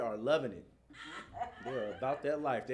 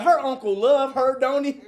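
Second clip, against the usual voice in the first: parents at the game; 12 semitones higher, 13 dB louder than usual. Retold or imitated.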